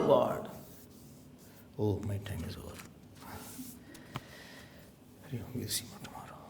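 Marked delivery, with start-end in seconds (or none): 1.8-6.4 soft, fast whisper to close; hushed goodbye, barely voiced; whispered sign-off. Real, not acted. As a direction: whisper that time is up. Closing aside off microphone.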